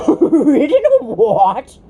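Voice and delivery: slow, loud, scornful retort; slow, loud contemptuous horse voice; extreme contempt. A narrator's reading. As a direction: sneer the words out in disbelief. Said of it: "retorted the horse with extreme contempt".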